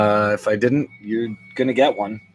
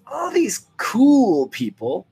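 high, drawn-out sarcastic sneer at COOL; air quotes on cool; 11 semitones higher, much slower than usual. Real, not acted. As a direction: stretch COOL way out, mockingly high. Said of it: "quotation marks around cool".

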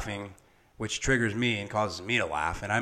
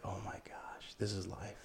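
hushed awe; 12 dB quieter than usual. Retold or imitated. New father at the birth, hushed awe. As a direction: say it quietly, overcome by the moment.

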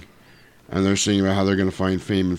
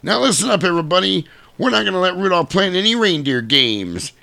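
higher-pitched hectoring announcement; bossy coach; 9 semitones higher than usual. Retold or imitated. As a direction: lay down the law in a put-on voice. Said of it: "decides to be an asshole also".

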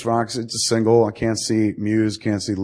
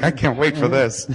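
slightly raised, eager mock voice; playful impression of excited anticipation; imitated excitement; 4 semitones higher than usual. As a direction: imitate someone who can hardly wait. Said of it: "He's getting excited. He's like".

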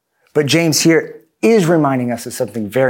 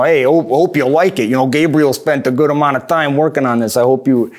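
casual, slightly louder Boston voice; hammed-up Boston accent; 3 dB louder than usual. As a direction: put on a Boston accent, talking offhand. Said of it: "that's my Boston accent".

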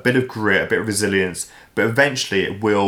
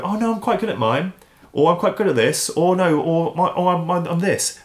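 high-pitched, hesitant protesting; mock self-assurance; 8 semitones higher than usual. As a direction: imitate yourself insisting you're good at it. Imitating own defensive self-talk.

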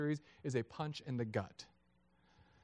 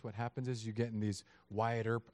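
normal talking voice

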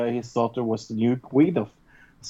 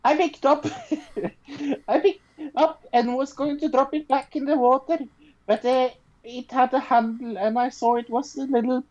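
put-on Swedish accent; 9 semitones higher than usual. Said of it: "I will do this in a Swedish accent".

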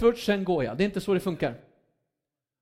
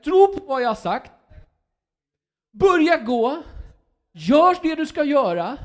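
preacher booming God's orders; commanding God; 7 semitones higher, 8 dB louder than usual. Acted. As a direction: order him to stop crying out and move. Preacher voicing God commanding Moses.

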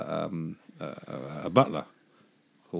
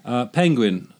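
normal talking voice

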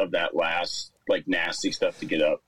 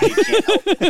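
squeaky laugh breaking through; can't hold the giggle in; stifled giggles; 11 dB louder than usual. Real, not acted. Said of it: "my defense mechanism is laughing".

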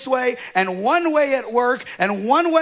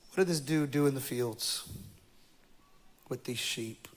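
much softer, low reflective preaching; intimate quiet preaching; 4 semitones lower, 11 dB quieter than usual. Acted. Pastor speaking to the congregation, hushed. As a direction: draw the room in close.

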